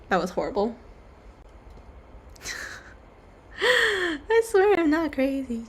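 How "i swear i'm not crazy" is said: laughing. Pretend. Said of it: "Well, maybe I am".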